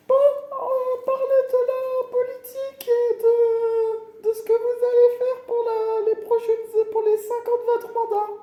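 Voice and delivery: very high, squeaky moderator; high-voiced debate moderator. Moderator asking candidates about their term, very high squeaky voice. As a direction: put on a tiny high voice to pose the question.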